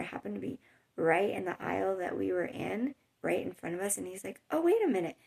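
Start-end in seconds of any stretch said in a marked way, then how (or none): 4.5-5.2 higher, louder imitated surprise; sudden realisation. Worker finds the coconut water beside them, surprised. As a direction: act out suddenly spotting it.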